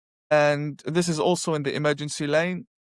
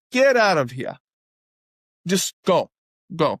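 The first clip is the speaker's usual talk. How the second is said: louder, shooing brush-off; shooing him off; 4 dB louder than usual. Retold or imitated. Speaker reenacts shooing the stranger off, impatient and louder. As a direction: wave him away, impatient. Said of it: "like that".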